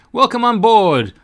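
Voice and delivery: high, loud pelican greeting; loud welcome. Acted. Pelican welcomes the cork aboard, loud and high. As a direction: sing out a big welcome.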